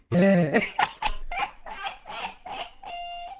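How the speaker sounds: squeaky cracking laugh; high-pitched giggle; strained high laugh. Real, not acted.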